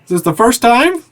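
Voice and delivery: high, loud laughing barkeep; amused bartender. Acted. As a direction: tease the customer like a jolly bartender.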